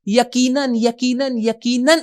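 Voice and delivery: یقیناً repeated three times, pitched high; insistent, high-pitched stress on each یقیناً; emphatic repetition. Shown as a demonstration. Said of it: "اس بات کو stress کرنا چاہتا ہے".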